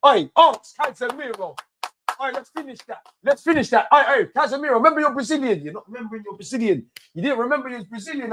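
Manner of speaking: high-pitched pleading call; calling out to a player. Real, not acted. Fan pleading with Casemiro on screen, high-pitched call.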